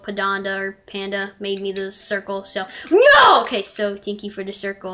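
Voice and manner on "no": sudden cry of no. Real, not acted. Parkour jump going wrong.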